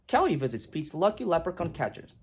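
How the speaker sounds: ordinary delivery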